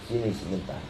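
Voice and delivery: lightly voiced dying parent, low and soft; a hint of a weak dying father calling. Dying parent calls children. (suara orang tua sekarat yang lemah)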